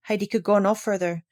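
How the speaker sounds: ordinary delivery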